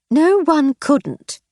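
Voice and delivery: put-on wife rebukes the drunk, sternly; stern reply. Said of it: "she replied sternly".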